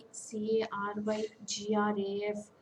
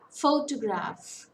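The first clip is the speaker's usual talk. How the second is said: slow, loud Photograph, PHO punched; stress on first syllable; 5 dB louder, slower than usual. Shown as a demonstration. Teacher showing a three-syllable word, first syllable stressed. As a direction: draw out Photograph, leaning hard on PHO. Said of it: "Stress falls on the first syllable".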